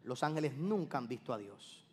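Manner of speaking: soft, grave restatement; hushed repeat. Shown as a demonstration. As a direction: drop to a near hush for "nunca" to land. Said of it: "Vuelvo y te lo repito".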